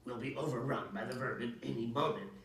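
ordinary delivery, nothing marked

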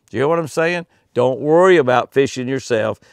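normal talking voice

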